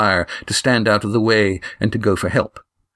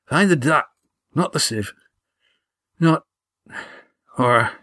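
higher, broken fragments with long pauses; struggling for breath; 4 semitones higher than usual. A narrator's reading. Wounded Gar trying to give friends directions, struggling for breath. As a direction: labour out each few words, breath failing. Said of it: "his breath bubbling in his throat as he tried to talk".

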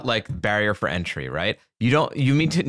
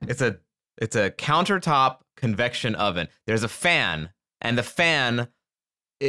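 usual speaking voice

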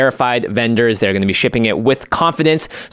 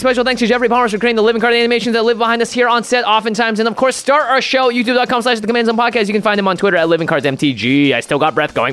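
all in one breath; 11 semitones higher than usual. Pretend. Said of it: "I've still got more breath. I can keep going".

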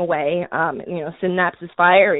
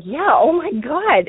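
higher, drawn-out astonished agreement; retold amazement; 7 semitones higher, 4 dB louder, slower than usual. Relatives confirming they hear a baby crying, amazed. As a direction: re-enact stunned relatives, slow and high.

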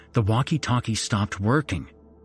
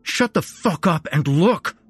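tense snap; 8 semitones higher, 5 dB louder than usual. A narrator's reading. Tense friend snapping back.

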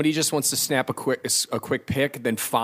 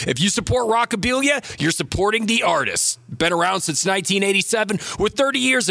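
punchy radio ad pitch; ad read; 7 semitones higher than usual. Acted. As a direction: build to the big call to action. Band merch ad read.

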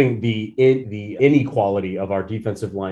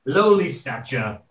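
put-on British accent; 2 semitones higher than usual. Complaining about a receiver in a light British accent. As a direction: grumble like a mock English lord. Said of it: "a little bit of [name]".

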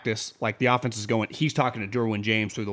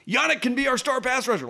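mock optimism; 10 semitones higher than usual. Mimics hopeful team's belief in player.

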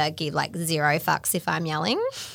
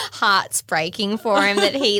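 ordinary talk, nothing marked

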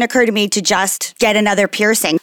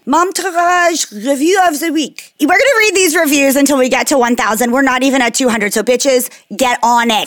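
fake French accent; 3 semitones higher than usual. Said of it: "I'm gonna do fake French".

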